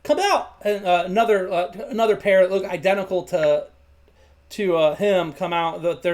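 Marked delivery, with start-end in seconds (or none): 0.0-0.6 put-on high, raised call to the kids; yelling back. Baker father summoning his boys, yelling. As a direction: holler for them to come out. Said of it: "He yells back".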